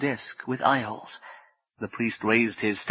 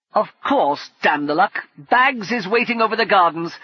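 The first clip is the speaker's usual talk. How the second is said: louder, higher annoyed outburst; exasperated curse; 9 semitones higher, 5 dB louder than usual. Acted. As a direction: curse the bad luck out loud. Brumman cursing on the roof.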